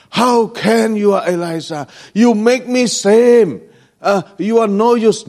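high, loud scolding rebuke; mock scolding God. God scolding a tired Elijah, high and loud. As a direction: scold him as useless. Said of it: "God didn't angry".

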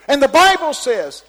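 wound-up preacher voice; young preacher all wound up. Retold or imitated. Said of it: "when the young preacher go".

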